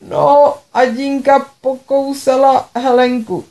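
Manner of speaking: mother on the phone, mildly tearful and slow; between sobs. Acted. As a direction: push the words out between sobs. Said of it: "sdělovala mi mezi vzliky".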